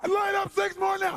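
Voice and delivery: high, loud screamed order; unhinged shouted command; ten times crazy. Retold or imitated. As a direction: cut in with a maniac yell. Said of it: "I go ten times crazy".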